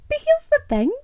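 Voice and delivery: here's the thing jumped very high and tight; squeaky high turn. Acted. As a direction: leap way up to announce the point. Dramatic turn in argument.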